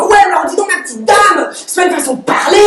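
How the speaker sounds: high, scolding put-on voice of the clerk; mock a pompous clerk telling her off; indignant clerk. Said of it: "il tape sur son comptoir comme ça, il fait".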